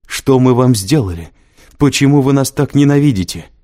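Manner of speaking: loud, high desperate scream; shouting. A narrator's reading. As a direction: hurl the questions in despair. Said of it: "закричала она".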